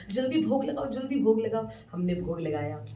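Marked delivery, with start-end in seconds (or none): 0.1-1.7 eager urging. Retold or imitated. Urging quick offering.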